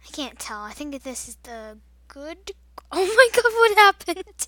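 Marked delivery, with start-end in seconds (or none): 2.9-4.5 laughing, loud and high; surprised laugh. Real, not acted. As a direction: burst out laughing in shock. Sudden surprise in game.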